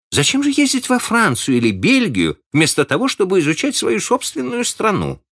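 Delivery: challenging question. A narrator's reading. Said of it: "Miss Ivors challenging Gabriel".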